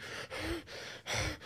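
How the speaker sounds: sharp high unvoiced gasp; a sudden catch of breath as panic hits; panicked gasp. Shown as a demonstration. Speaker acting out the moment panic arrives, sharp gasp. Showing anxiety hitting him.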